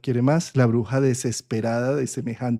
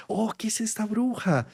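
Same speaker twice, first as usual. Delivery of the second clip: a touch of mock fright, higher and softer; susto imitado; 8 semitones higher, 5 dB quieter than usual. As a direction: gasp it lightly as if scared of the witch. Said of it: "ella se asusta y dice". (susto fingido, más agudo y suave)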